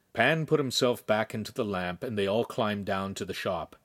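ordinary delivery

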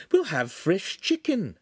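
a refined lady politely declining; signora's voice. Acted.

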